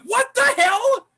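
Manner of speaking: high, loud, slow disbelief; retold shock. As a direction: stretch out the disbelief. Retold shock at being cuffed.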